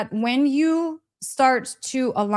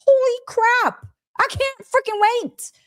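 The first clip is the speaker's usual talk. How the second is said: excited; 12 semitones higher than usual. Real, not acted. Excited about upcoming tour.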